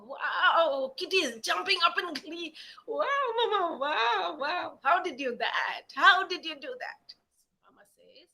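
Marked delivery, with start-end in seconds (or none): 0.0-7.1 high, loud amazed wows; gleeful kitten. A narrator's reading. Kitten amazed at mother's barking, high gleeful wows. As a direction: squeal in delight at mama.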